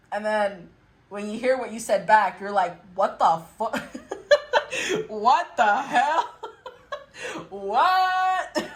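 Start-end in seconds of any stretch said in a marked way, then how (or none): 2.9-8.7 incredulous, breaking into laughter; mock disbelief. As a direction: stretch the words out, cracking up between them. Imitates hearing own words back.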